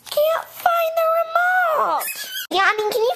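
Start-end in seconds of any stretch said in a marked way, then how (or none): 0.1-2.0 high slow shout. Acted. Shouted complaint.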